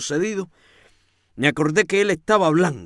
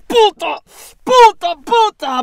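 high, much louder repeated scream; screaming insult; 8 dB louder, slower than usual. A narrator's reading. The man screaming an insult at Rosaura, full shout. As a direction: hurl the word at her with full force. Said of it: "ese feto grita".

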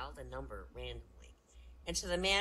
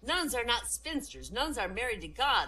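agitated, higher and louder correction; 8 semitones higher, 9 dB louder than usual. Acted. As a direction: protest loudly, stressing each point. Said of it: "the nun got agitated and said".

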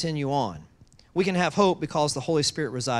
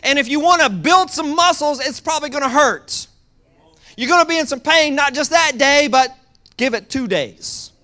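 loud, high, driving sermon voice; full-force preaching; 9 semitones higher, 8 dB louder than usual. Acted. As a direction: preach it hard to the congregation.

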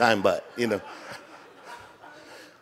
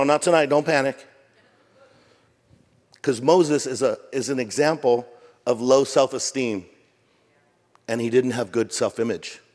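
ordinary delivery

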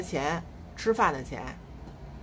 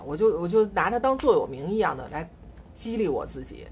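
ordinary talk, nothing marked

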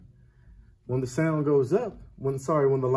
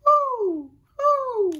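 high hooting owl call; 7 dB louder than usual. Shown as a demonstration. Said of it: "Try that".

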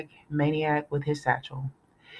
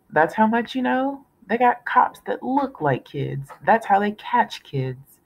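put-on 1 sneering kid; 7 semitones higher than usual. A narrator's reading. Russell sneering at Piper.

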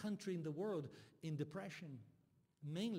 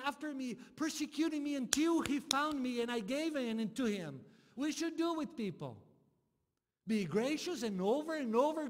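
high, loud, building preacher's cry; fervent sermon peak, loud and high; preaching at full force; 10 semitones higher, 8 dB louder than usual. Real, not acted. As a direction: preach it out, pushing louder and higher. Preaching climax, rising intensity.

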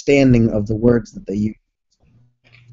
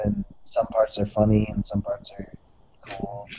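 ordinary delivery

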